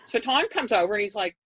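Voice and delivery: ordinary delivery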